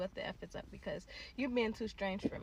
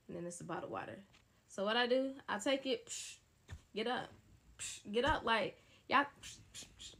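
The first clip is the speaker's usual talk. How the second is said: normal talking voice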